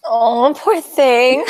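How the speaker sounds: long drawn-out, high cooing sympathy; sympathetic. Real, not acted. Listener consoling someone who stutters, sympathetic. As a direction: coo it slowly with pity.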